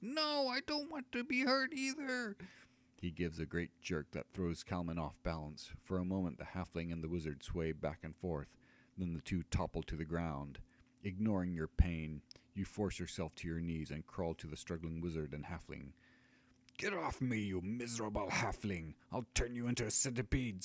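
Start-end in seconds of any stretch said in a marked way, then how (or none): 0.0-2.4 high, shrill-pitched scream of a small halfling; high halfling screaming in panic; halfling's furious scream. Acted. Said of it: "Loris screams". 16.8-20.6 higher, louder furious wizard; wizard's angry scream. Acted. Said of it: "screams the wizard".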